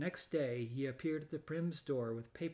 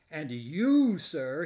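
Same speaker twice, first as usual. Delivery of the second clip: stern accusation; 10 dB louder, much slower than usual. Acted. Angry manager turns on Valente.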